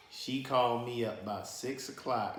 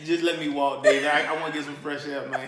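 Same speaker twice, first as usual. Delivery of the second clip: louder, fast, laughing; mock pleading; 4 semitones higher, 10 dB louder than usual. Marcus asking David to let him walk, laughing plea. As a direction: beg to be let out. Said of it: "He like".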